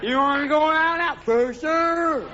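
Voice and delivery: put-on two drunks mumbling, all vowels; drunk slur. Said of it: "someone else that drunk going".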